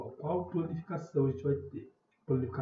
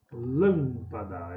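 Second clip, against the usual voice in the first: LÂM held long in Lâmpada; stressed first syllable; 3 semitones lower, 3 dB louder, much slower than usual. Shown as a demonstration. Teacher showing pupils the tonic syllable, stressed LÂM in Lâmpada. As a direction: stretch and lean on LÂM in "Lâmpada". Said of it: "é o lã".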